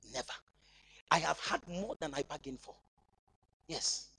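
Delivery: usual speaking voice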